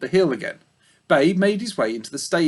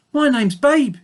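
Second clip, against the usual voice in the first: high, cheerful pig voice; jolly piglet; 11 semitones higher, 4 dB louder than usual. Acted. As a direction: introduce himself brightly and friendly. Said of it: "he said in a jolly voice".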